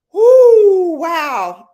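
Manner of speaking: high, loud whoop; excited woo. Real, not acted. Overwhelmed by viewers joining, a loud high whoop. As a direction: let out a joyful whoop. Reacting to busy chat.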